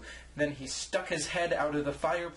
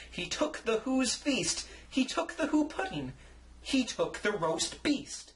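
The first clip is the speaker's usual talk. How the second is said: higher, climbing list of loot; building list; 7 semitones higher than usual. A narrator's reading. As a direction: pile up each stolen thing. Narrator building the theft list.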